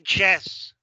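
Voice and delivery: YES mispronounced as "jess", with a hard J; wrong YES, punched J at the start; hard J mistake. Shown as a demonstration. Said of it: "No va a ser yes".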